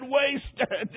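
high, anguished imitated sob; sobbed cry. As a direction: sob the word out in despair. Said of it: "Then he sobbed and said".

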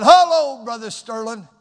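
loud, high-pitched hail to a friend; hearty loud greeting. Retold or imitated. Preacher greets an old co-worker backstage, hearty loud hail. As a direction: call out a big high hello, then settle on the name.

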